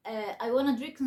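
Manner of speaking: ordinary talk, nothing marked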